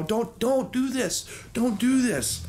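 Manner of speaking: high, pleading little cat voice. Acted. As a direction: beg her to stop like a tiny cat. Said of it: "they're telling her".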